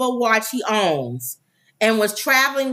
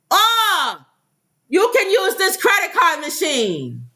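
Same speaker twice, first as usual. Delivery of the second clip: higher, bright mock officer voice; 8 semitones higher than usual. Host mimics the new officer offering the card machine in a higher voice. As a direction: chirp it like a helpful officer.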